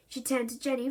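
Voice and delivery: usual speaking voice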